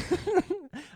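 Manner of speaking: high-pitched burst of giggling; high giggle. Real, not acted. Joke about porn.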